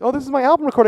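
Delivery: bright proud announcement; chirpy and pleased with yourself; cheery, high and loud. Pretend. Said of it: "I'm gonna go in there like".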